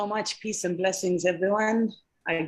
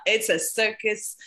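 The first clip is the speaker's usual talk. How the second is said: laughing; 5 semitones higher, 6 dB louder than usual. Real, not acted. Joking about wearing many hats.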